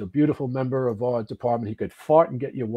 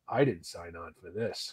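inner thought; 8 semitones lower, 11 dB quieter than usual. Retold or imitated. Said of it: "I remember thinking".

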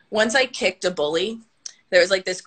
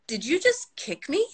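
high, puzzled question; mock-incredulous higher voice; imitated disbelief; 8 semitones higher than usual. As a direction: play the bully baffled by a kick. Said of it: "turned around and looked at me".

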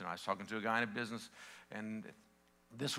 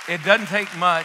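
"it doesn't take much" said louder and higher, pressing each word; emphatic first take; 7 semitones higher, 16 dB louder than usual. Real, not acted. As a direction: punch the line out to the room. Repeats phrase, first take emphatic.

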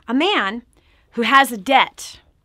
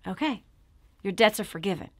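lower, softer royal forgiveness; king's pardon; 5 semitones lower, 8 dB quieter, faster than usual. Retold or imitated. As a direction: grant mercy gently like a king. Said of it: "So the king says".

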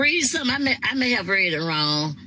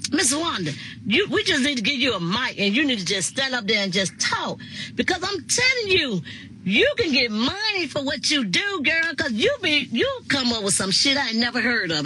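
retold admirers; 6 semitones higher than usual. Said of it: "And so they was like".

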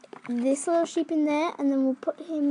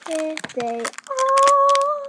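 excited reveal; 11 semitones higher, 5 dB louder, much slower than usual. Real, not acted. Revealing toys to viewers.

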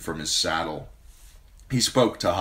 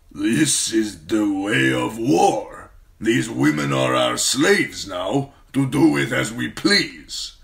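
slow, loud, higher warlord pronouncement; stern khal; 6 semitones higher, 8 dB louder, slower than usual. Acted. Khal tells his queen the way of war, stern slow voice. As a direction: lay down the law of war.

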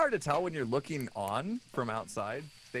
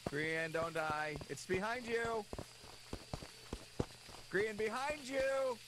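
urgent warning; 8 semitones higher, 5 dB quieter, slower than usual. Acted. Warning teammate hunted by ghost.

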